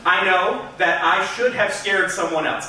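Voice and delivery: ordinary talk, nothing marked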